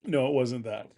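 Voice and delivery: soft, offhand brush-off; dismissive. Retold or imitated. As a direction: quietly wave the idea away in mock imitation. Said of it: "just the dismissive".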